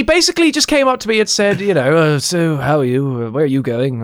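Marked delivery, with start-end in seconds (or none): 2.0-4.0 lightly voiced old man. Voicing old man at bus stop.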